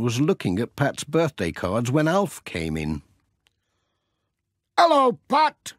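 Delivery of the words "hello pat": much higher, louder hearty farmer greeting; a jolly farmer calling out to Pat; Alf Thompson. Acted. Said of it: "he said".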